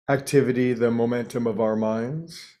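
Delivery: ordinary delivery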